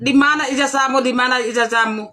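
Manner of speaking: usual speaking voice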